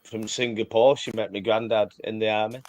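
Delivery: normal talking voice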